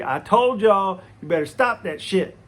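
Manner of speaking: put-on half-laughing older-brother scolding; scolding yell. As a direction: chew them out, voice up. Scolding brothers in retold scene.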